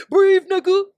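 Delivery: high, loud barked order; mock yelled CPR command. A bro doing CPR on his drowning friend, yelling. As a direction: yell it while pounding his chest. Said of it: "banging on your chest".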